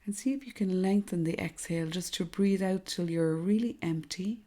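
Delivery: soft, hushed meditation voice with long pauses; guided meditation. Acted. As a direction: guide them gently through each breath, softly and unhurriedly.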